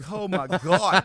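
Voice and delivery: loud, high retold amazement; mock astonishment. American reacting to the lawyer's confession, hammed-up astonishment. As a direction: exclaim it high and loud, playing up disbelief.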